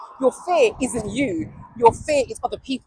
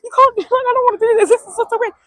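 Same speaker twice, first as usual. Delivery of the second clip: panicked refusal; 12 semitones higher, 9 dB louder than usual. Retold or imitated. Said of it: "I was like".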